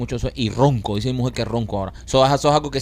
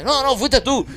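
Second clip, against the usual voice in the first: mock accusing; 12 semitones higher, slower than usual. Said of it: "Y dice".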